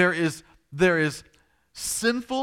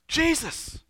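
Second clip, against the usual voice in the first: very high impatient call; antsy; 13 semitones higher than usual. Acted. Antsy father calling to the stalled Jesus, impatient. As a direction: call out the name restlessly. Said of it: "he's getting antsy".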